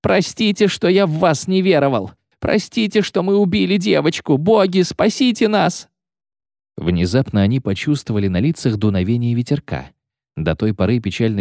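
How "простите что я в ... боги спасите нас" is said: high, wailing lament; moaning plea. A narrator's reading. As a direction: beg the gods in terror. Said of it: "стонал Кевинард Ван Влит". (стонущая мольба высоким голосом)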